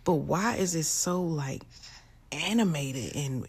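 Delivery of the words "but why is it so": normal talking voice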